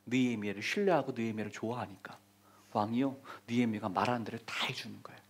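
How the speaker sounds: hushed whisper. Acted. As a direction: whisper it, low and secret. Dramatic hushed climax of story.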